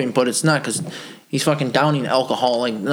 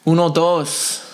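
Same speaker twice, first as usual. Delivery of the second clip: slow gringo Spanish with a laugh; American accent Spanish; 4 semitones higher, much slower than usual. Pretend. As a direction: count in Spanish like a tourist. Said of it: "now you just sound like a gringo".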